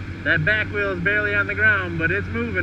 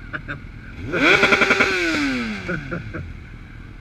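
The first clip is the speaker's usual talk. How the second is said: hearty laugh; 6 semitones higher than usual. Real, not acted. Laughing at the tire.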